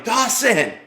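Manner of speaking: high, loud shout across a crowd; yelling. Retold or imitated. Father calling for his lost son, yelling. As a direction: call out the name, drawn out and urgent. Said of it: "I started yelling".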